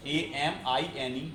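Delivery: एएमआईएनई spelled letter by letter, very slowly; slow spelling. Shown as a demonstration. Teacher spelling the ligand name for students, very slowly. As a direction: drag out each letter of एएमआईएनई. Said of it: "जिसको हम बोलते हैं amine".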